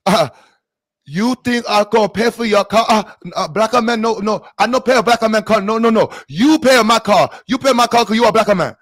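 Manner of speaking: put-on high, loud Chinese-accented refusal; mock Chinese accent. Driver refusing to pay for car damage, high mock Chinese accent. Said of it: "Then the Chinese".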